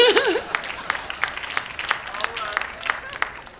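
amused giggle at yourself; long, trailing chuckle; laughing. Real, not acted. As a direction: laugh it off after being silly. Laughs at own silly songs.

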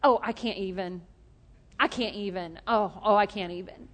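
imitating a teenage daughter's catchphrase; mildly mocking daughter's jaded phrase. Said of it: "She goes".

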